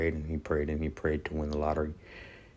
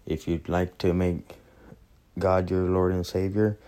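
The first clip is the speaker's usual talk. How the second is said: usual speaking voice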